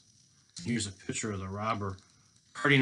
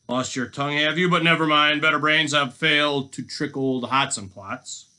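louder, taunting robber; boastful storybook villain; gloating boast; 5 semitones higher, 10 dB louder than usual. Acted. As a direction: crow over a silent captive. Robber boasts to silent captive.

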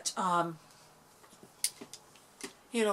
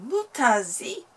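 put-on French Canadian accent; 4 semitones higher, 8 dB louder, slower than usual. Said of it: "French Canadian accent".

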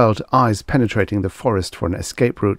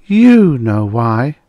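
slow, loud accusation, pitched up; accusing; 4 semitones higher, 8 dB louder, much slower than usual. A narrator's reading. Girl with sword blames the man, accusing. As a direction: draw it out as an accusation. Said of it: "she accused".

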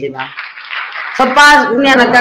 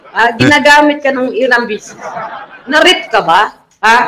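ordinary delivery

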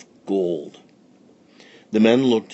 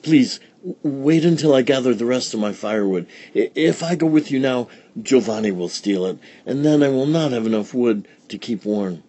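fast, higher, stammering; flustered old woman; 4 semitones higher, 3 dB louder, faster than usual. Acted. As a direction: babble in a rush. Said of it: "said Befana".

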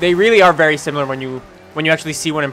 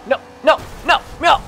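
frantic no; 9 semitones higher than usual. Real, not acted. Losing the lead.

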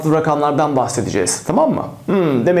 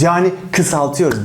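normal talking voice

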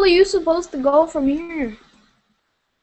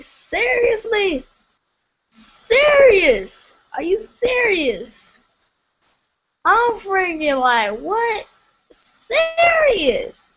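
rising, higher, drawn-out disbelief; incredulous; 6 semitones higher, much slower than usual. Real, not acted. Streamer upset at having no subscribers, incredulous and whiny. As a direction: keep asking it, pitch climbing each time.

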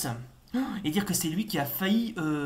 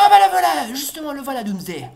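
much higher, louder, thrilled cry; excited surprise; 10 semitones higher, 13 dB louder than usual. Real, not acted. Gamer spotting Doomsday at last, high loud surprise. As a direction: shout as the boss finally shows up.

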